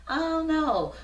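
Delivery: high, airy mock shrug; a hammed-up clueless "I don't know"; mock clueless answer. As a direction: play someone who has never thought about it. Imitating people who don't know themselves.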